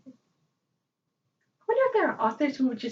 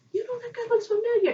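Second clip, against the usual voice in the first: higher and quicker, catching on; excited high realization; 10 semitones higher, 4 dB louder, faster than usual. Retold or imitated. Fan recognizing the character, high and quick. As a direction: spot it with excited surprise. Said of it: "I was like".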